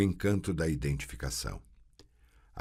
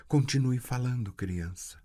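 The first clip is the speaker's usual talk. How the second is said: plain reading voice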